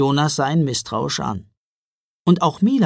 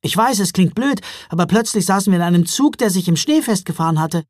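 put-on fast, higher eager boy voice; 6 semitones higher, 6 dB louder, faster than usual. A narrator's reading. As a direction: rush the story out like an excited boy. Jonas explaining eagerly. (aufgeregter Junge, schnell und höher)

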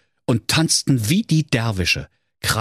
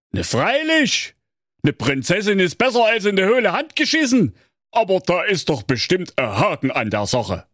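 higher, cheeky Saxon lad; Thorsten; 11 semitones higher than usual. A narrator's reading. Thorsten answering King Curry, cheeky and unafraid. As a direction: answer like a carefree young fool.

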